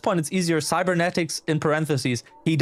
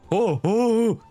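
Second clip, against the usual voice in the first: pained groans; 8 semitones higher, 3 dB louder, much slower than usual. Acted. Larson's pained reaction.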